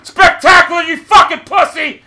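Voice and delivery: furious insult. Real, not acted. Gamer cursing a camper in corners, furious shout. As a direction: hurl it at the enemy in real rage. Player insults a camping enemy.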